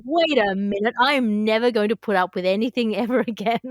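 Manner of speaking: normal talking voice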